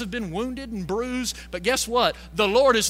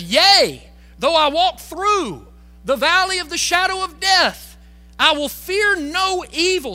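preaching at full force; 7 semitones higher, 7 dB louder than usual. Acted. Preacher proclaiming the verse.

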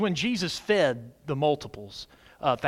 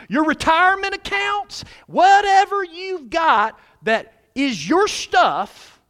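preaching; 10 semitones higher, 4 dB louder, slower than usual. Acted. Pastor building a list to congregation.

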